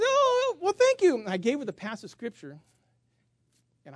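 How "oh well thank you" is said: high, loud, sugary thank you; fake sweet thanks. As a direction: gush politely while hiding irritation. Pastor's mock sweet reply.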